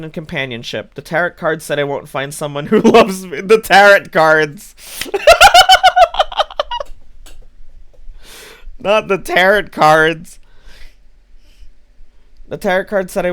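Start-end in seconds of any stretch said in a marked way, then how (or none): 2.7-3.4 reading the fortune out while laughing; laughing as she reads it out; laughing through it. Real, not acted. Reading out her fortune, amused. 3.4-10.4 loud, slow, laughing disbelief; loud laughing outburst at the tarot cards. Real, not acted. Incredulous at tarot advice.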